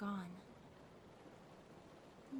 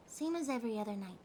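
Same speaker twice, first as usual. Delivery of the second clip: ordinary delivery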